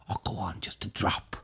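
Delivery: whispered, tempting aside; coaxing whisper. A narrator's reading. Old Tag tempts the hussar with brandy in a whisper. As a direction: whisper it like a little secret.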